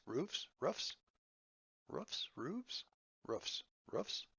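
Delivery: soft, questioning "roofs", flipping between "roofs" and "rooves"; unsure plural. Shown as a demonstration. GM unsure of the plural, softly questioning each "roofs". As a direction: test "roofs" under your breath with F, then V, each rising. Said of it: "I don't know".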